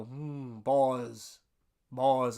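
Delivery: a hint of a Southern dean addressing the boys from the stage; Dean Bailey's Southern drawl. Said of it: "Dean Bailey would always say to us".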